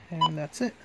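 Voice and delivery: usual speaking voice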